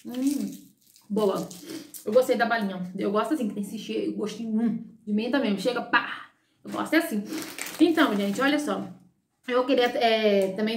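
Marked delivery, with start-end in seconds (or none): 0.0-8.9 talking around a sweet; sucking hard candy; candy in mouth. Real, not acted. Seen 1: Puts unwrapped candy in mouth at -5; talks while sucking it.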